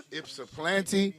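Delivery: slow "Ypsilanti" with the P moved before the L; mispronounced, P before L. Retold or imitated. Mocking a rapper's mistake, mispronounced with P before L. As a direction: say "Ypsilanti" as Ip-suh-PLAN-tee, drawn out. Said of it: "the L come before the P".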